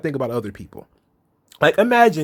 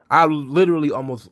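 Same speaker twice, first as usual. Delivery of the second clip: usual speaking voice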